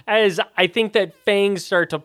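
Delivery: ordinary talk, nothing marked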